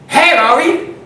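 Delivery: loud, high seagull hailing a driver; seagull calling out. Acted. Seagull stops a driver in his tracks, loud high call. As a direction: shout a greeting as a cocky bird. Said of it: "This bird stops me in my track".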